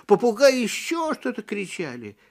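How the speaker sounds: ordinary delivery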